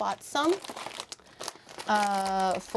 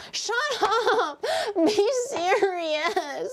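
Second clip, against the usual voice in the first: cracking up while telling chat off, higher; half-laughing protest, real giggles through it; laughing yell; 9 semitones higher, much slower than usual. Real, not acted. Teased by chat, she yells at them through laughter.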